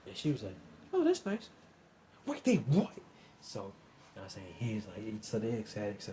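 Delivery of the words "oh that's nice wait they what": very high mock mom voice; mom's voice. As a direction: squeak it way up like a casual mom.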